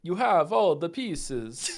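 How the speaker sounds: slow, dramatic vampire drawl. Shown as a demonstration. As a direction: hiss it out like a feral vampire. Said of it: "in your Von Boulder vampire voice".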